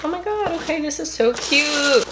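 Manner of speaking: real delight, voice lifted high; delighted gushing. Real, not acted. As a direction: coo over something adorable. Opening package, delighted reaction.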